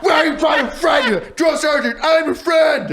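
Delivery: very high, loud, laughing shouts; privates calling out. Retold or imitated. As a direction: yell like a whole platoon answering at once. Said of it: "and they're like".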